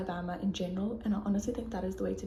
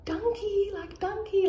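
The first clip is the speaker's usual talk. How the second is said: "Dankie" sung back much higher in a bad Afrikaans accent; 11 semitones higher, slower than usual. [name] and I answer the owner, bad Afrikaans accent. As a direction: parrot "Dankie" high and playful in a clumsy Afrikaans accent. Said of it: "My accent's so bad, my Afrikaans accent".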